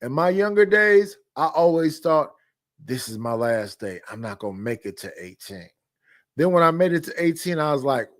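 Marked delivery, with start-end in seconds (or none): 2.8-5.7 hushed. Retold or imitated. Recalling his youthful hopelessness.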